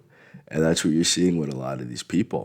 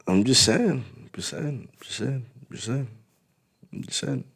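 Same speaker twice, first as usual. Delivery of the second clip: teasing; 6 semitones higher, 5 dB quieter than usual. Real, not acted. Teasing after calling himself dick.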